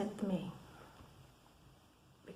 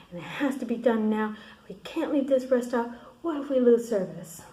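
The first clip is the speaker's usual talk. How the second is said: louder, higher frantic protest; acted urgent insistence; 4 semitones higher, 8 dB louder than usual. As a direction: imitate someone insisting in a panic. Voicing Don's urgent insistence.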